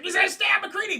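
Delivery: high, loud shouted warning; hammed-up panicked warning; mock alarmed yell. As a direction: shout it as if the knife is out. Acted warning in film scene.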